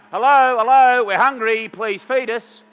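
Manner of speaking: mock plea from hungry cells down the phone; cells calling room service. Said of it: "they're going".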